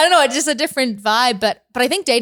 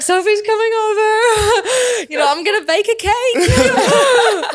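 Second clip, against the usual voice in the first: high-pitched mock jitters; giddy, over-eager panic played for laughs; first date nervous; 10 semitones higher than usual. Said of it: "I was like, first date nervous".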